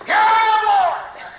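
high, loud yeah; shout of praise. Real, not acted. Preacher shouting praise to the congregation, high and loud.

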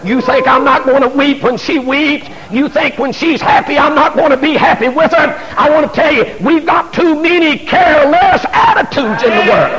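high, shouted preaching; full-force preaching. Acted. Preacher shouting to congregation about careless attitudes. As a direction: lift the room with rising fervour.

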